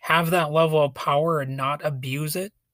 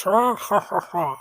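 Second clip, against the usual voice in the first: sinister laugh; 4 semitones higher than usual. Pretend. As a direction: laugh like a villain. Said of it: "almost more like a sinister laugh".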